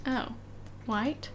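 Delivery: ordinary delivery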